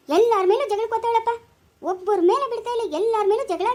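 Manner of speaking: high, loud exasperated scolding; loud, fed-up mother; angry scolding. Acted. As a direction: scold her loudly for fighting everyone.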